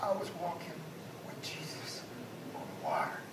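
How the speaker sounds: whispered, savoring each word; hushed awe. Retold or imitated. Preacher repeating Peter's boast in an awed whisper. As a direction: breathe it out like a secret treasure.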